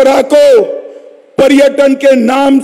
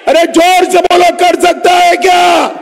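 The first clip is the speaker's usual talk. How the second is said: crowd call; 7 semitones higher than usual. Real, not acted. Urging crowd to shout back.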